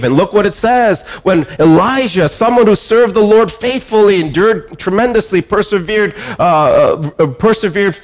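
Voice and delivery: rising pulpit fervor; fervent preaching. Acted. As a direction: raise the sermon to a passionate climb.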